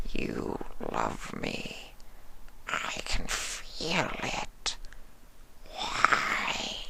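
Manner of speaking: puzzled creature sensing love; slow wondering changeling. A narrator's reading.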